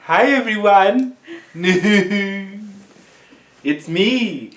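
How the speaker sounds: high, hammy showman intro; exaggerated cartoon intonation. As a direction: overplay it like a cartoon fairy. Playful over-the-top intro.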